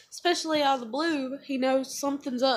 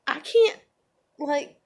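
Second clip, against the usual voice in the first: anguished outburst; 2 semitones higher, 5 dB louder, slower than usual. Real, not acted. Daughter tells absent dad she can't ask, anguished outburst. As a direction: let it burst out in real pain.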